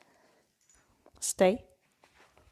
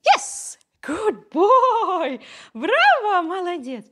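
high, loud gushing praise; excited praise; 10 semitones higher, 5 dB louder, slower than usual. Real, not acted. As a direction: cheer the pup on. Praising dog after success.

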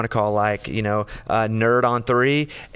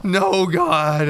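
laughing protest; 7 semitones higher, much slower than usual. Real, not acted. Embarrassed host to Coach, a laughing high protest. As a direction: laugh through the protest, pitch jumping up.

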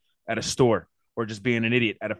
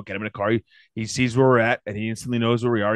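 ordinary talk

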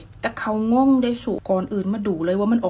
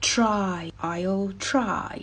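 very slow "try I'll try", each word drawn out; slow practice reading; 4 dB quieter, much slower than usual. Shown as a demonstration. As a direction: read "I'll try" slowly for learners. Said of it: "ออกเสียงเป็นคำๆได้แล้ว เอามาฝึกใช้ในประโยค".

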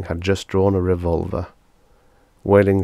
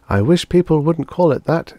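high, louder woman's complaint; receptionist character; 10 semitones higher, 6 dB louder than usual. A narrator's reading. Receptionist objects to doctor's slang, high-voiced. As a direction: object in a raised, pained pitch.

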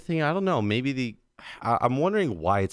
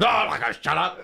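defiant mobster; 8 semitones higher than usual. Retold or imitated. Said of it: "Oh, he's like".

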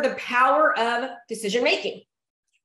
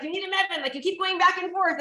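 mom's voice; 6 semitones higher than usual. Retold or imitated. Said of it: "So she's like".

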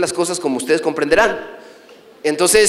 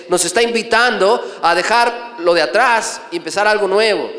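ordinary delivery

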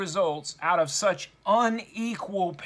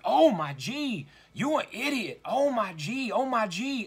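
higher childish mock yell; imitating a yelling child; 4 semitones higher than usual. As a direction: play a twelve-year-old girl shrieking at a kid. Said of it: "suddenly yelling at another one of the little children".

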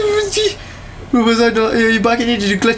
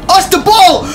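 loud, high-pitched startled cry; startled exclamation; 10 semitones higher, 5 dB louder than usual. Real, not acted. Gamer startled by a moving ball, exclaiming loudly. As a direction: blurt it out loudly, pitch shooting up.

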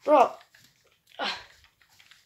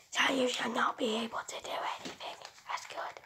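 whispered menacing toy robot; 2 semitones lower, 8 dB quieter than usual. Acted. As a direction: whisper like a creepy little robot. Robot speaks to its father.

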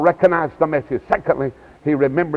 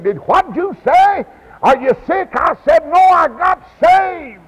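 high-pitched, raised-voice phone exchange; excited exclaiming; 12 semitones higher, 4 dB louder than usual. Retold or imitated. Mother and son on the phone over his salvation, excited exclaiming. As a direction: exclaim it as if down a bad line.

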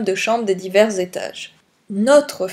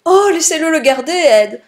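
high, louder pleading exclamation; crying out; 6 semitones higher, 5 dB louder than usual. A narrator's reading. Wife begs husband over the necklace, crying out. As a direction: burst out in agitated appeal. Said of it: "s'écria sa femme".

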